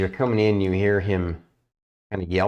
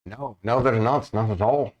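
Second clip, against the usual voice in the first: worried guild master, lightly voiced, a bit higher; guild master NPC; 7 semitones higher than usual. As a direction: GM playing a fretful guild master breaking bad news. GM voices Mister Zolk.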